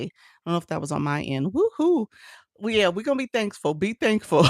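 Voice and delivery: ordinary talk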